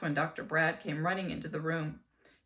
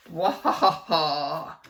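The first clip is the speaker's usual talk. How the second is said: loud, slow evil cackle; mad scientist laugh; 2 semitones lower, 9 dB louder, slower than usual. Shown as a demonstration. Reader shows the kids the evil laugh. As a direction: rub your hands and cackle like a mad scientist. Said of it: "in your most evil, mad scientist voice".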